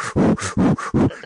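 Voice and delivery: panicked panting. Retold or imitated. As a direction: huff and puff in mock panic. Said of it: "like, proper panicking".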